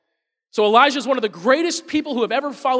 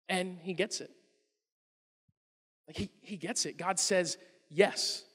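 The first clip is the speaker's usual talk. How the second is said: hushed awe; 2 semitones lower, 10 dB quieter than usual. Real, not acted. Awed climax of the story.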